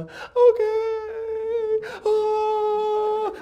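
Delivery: high, drawn-out mock crying; exaggerated tearful wail; wailing boy. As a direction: bawl like a hurt little kid. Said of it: "stop making all that noise".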